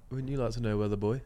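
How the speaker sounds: put-on quoting the viral kid, softly teasing; sassy kid quote. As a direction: tease him like the cheeky kid. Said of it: "have you not seen that clip".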